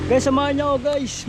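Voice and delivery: bright eager call to the viewers; excited. Real, not acted. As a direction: pump up the audience.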